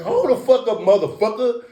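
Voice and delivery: retelling a confrontation with Joe Fob, a mild mock yell; retold yell. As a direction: bark it back at him, half-played. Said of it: "I said".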